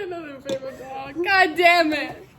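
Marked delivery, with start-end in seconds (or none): 1.2-2.3 loud exasperated curse; frustrated shout. Real, not acted. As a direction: bark it out, fed up. Spill, cleaning up angrily.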